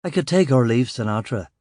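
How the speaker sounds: usual speaking voice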